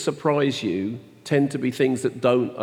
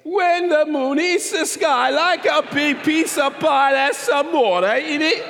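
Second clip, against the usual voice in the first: high, slow hammy Italian crooning; sing-song mock Italian; Jared Leto Italian accent; slower than usual. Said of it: "Jared Leto would say it".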